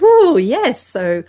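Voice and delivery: thrilled and a little naughty; mock thrilled cooing; high excited ooh. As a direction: squeal the ooh high and loud, then playful.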